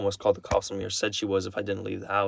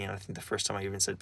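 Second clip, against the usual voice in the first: ordinary talk, nothing marked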